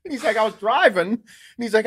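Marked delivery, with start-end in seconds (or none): 0.4-1.1 high, loud wired-dad impersonation; frantic retold confession; jacked up. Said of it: "like, a little jacked up".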